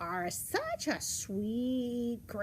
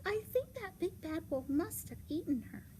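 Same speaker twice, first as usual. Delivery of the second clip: put-on high little-girl voice; high-pitched childlike dismay; 9 semitones higher than usual.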